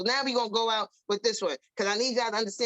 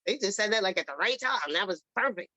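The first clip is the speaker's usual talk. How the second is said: grudging impressed; 5 semitones lower than usual. Retold or imitated. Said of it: "You be like".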